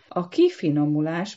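ordinary delivery, nothing marked

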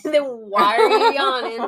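laughing. Real, not acted. Teasing co-host, laughing.

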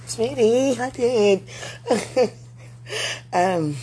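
loud, high flustered confession; embarrassed giggly. Real, not acted. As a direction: blurt it out, giggling.

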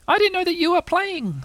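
very high little-creature voice; excited Roo. Acted. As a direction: pipe up in a tiny voice. Roo's put-on voice.

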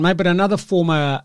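ordinary talk, nothing marked

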